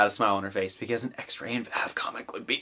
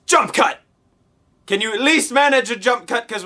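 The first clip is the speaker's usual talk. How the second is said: mock demand; 9 semitones higher, 3 dB louder than usual. Mock yelling at the editor.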